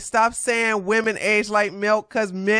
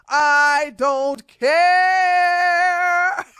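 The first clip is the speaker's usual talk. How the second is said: dragged-out, laughing I don't care; laughing defiance; 7 semitones higher, 4 dB louder, much slower than usual. Real, not acted. Laughing off a commenter, drawn out. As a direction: stretch it long and crack up.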